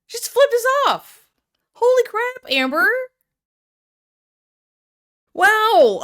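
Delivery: high, loud shocked exclamation. Real, not acted. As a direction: react in disbelief at the gesture. Host shocked by the clip.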